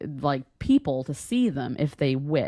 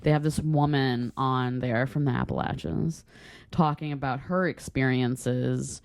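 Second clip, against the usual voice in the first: ordinary talk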